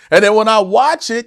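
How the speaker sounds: ordinary talk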